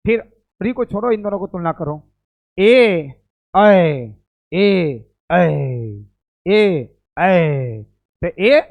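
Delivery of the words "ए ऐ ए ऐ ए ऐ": ए short, ऐ drawn out and louder; teacher demoing vowel length; short and long vowel pair. Shown as a demonstration. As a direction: clip ए, stretch ऐ. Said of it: "जल्दी हो गया".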